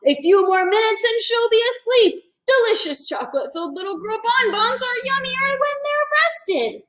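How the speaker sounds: mock-evil gloating dinosaur. A narrator's reading. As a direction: gloat over the trap. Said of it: "a dinosaur gloating".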